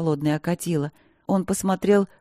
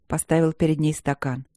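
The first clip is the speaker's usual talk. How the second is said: ordinary delivery, nothing marked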